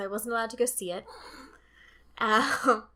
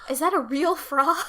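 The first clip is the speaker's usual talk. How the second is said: loud, raised-pitch silly question; clueless questioner; 4 semitones higher, 8 dB louder than usual. Acted. Imitating somebody who doesn't know Kermit, clueless questioner. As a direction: ask it loudly like someone baffled. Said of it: "unless somebody's like".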